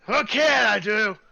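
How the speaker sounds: high, loud, slow dwarf echoing the question; offended dwarf repeating the question; gruff dwarf voice. Acted. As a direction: scoff at the question like a proud smith. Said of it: "is a dwarf".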